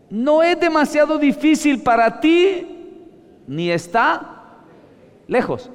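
fiery preaching. Acted. Preacher shouting the verse to the congregation, fiery. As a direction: proclaim it as if from the pulpit. Preaching Scripture at full force.

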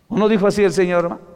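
ordinary talk, nothing marked

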